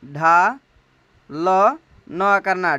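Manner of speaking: "ढा" then "ल न करना" split slowly into syllables. Shown as a demonstration. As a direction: break the word into syllables slowly. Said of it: "ढालना या फिर डालना". ("ढालना" को धीरे धीरे तोड़कर बोलना)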